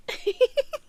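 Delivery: a short, high, breathy little laugh at herself; soft laugh. Acted. Voice in his head laughing softly at herself. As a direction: laugh lightly at your own lack of a body. Wry laugh at own bodilessness.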